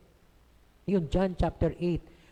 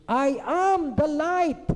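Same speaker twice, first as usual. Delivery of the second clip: high, loud and slow proclamation; Jesus proclaiming to the crowd, loud and high; proclaiming shout; 10 semitones higher, 5 dB louder, much slower than usual. Acted. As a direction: cry it out as a bold claim. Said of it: "biglang ito sisigaw si Jesus".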